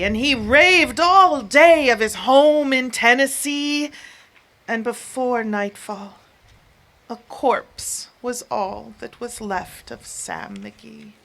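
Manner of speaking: ordinary delivery, nothing marked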